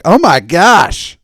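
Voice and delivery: loud, slow oh my gosh; loud stunned gasp. Retold or imitated. Hearing the clerk's reasoning, stunned and loud. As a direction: let the shock spill out.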